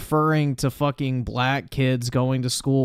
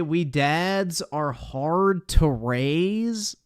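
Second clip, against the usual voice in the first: slow, drawn-out incredulous repeat; mocking disbelief; 3 semitones higher, much slower than usual. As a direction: echo the nonsense slowly. Repeating Biden's garbled line.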